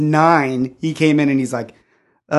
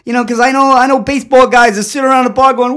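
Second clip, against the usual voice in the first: high, loud Boston comic impression; Boston accent; 9 semitones higher, 6 dB louder than usual. Comedian describing baseball fans in the bar, mock Boston accent. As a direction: argue it like a brash Boston guy. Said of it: "he's got this thick Boston accent".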